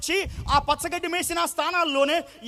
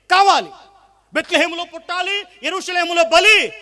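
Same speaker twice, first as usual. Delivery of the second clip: forceful preaching; 3 semitones higher, 8 dB louder than usual. Acted.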